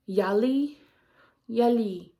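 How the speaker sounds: yali, ya-lee with a Chinese lilt; Chinese word. Shown as a demonstration. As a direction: Chinese yali, ending on a fall. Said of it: "Chinese was".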